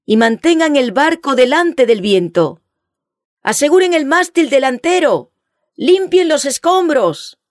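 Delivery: high, loud urgent commands, slowing at the end; frantic retreat order. A narrator's reading. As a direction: shout the orders over the storm. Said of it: "gritó el capitán".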